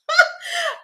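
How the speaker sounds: short high falling laugh; quick bright laugh. Real, not acted. Laughs at her own joke about hearts.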